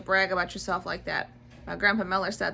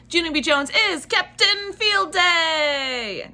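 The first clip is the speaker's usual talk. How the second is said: drawn-out, loud, higher title call; excited title announcement; 8 semitones higher, 7 dB louder, much slower than usual. Acted. Host announcing the book title to kids, slow and excited. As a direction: announce the title like a big reveal.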